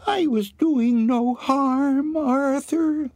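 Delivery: very high, slow, wounded ghost-hog falsetto; Anne's voice. A narrator's reading. Anne's ghost, as a hog, reproaching Arthur, high and wailing. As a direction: plead in a drawn-out high squeal. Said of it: "it was Anne's voice he heard".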